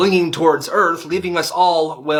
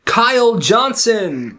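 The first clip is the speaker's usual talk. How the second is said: slow, louder announcer naming the winner; slow announcer reveal; 7 semitones higher, 3 dB louder, much slower than usual. Acted. Host announces the winner at graduation, slow and grand. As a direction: draw out each name for suspense.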